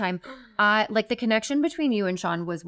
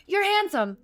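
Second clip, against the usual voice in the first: high-pitched dazed blurt; starstruck blurt; 12 semitones higher than usual. Retold or imitated. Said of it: "I'm like".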